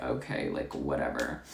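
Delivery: resigned mutter. Retold or imitated. Cashier giving up on a difficult customer, low resigned mutter. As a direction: drop it under your breath.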